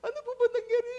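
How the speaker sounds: high, laughing mock sob; mock crying. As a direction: sob along comically. Pastor joining the crying, comic. (pabirong paiyak na tanong)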